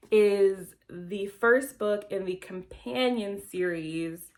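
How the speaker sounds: normal talking voice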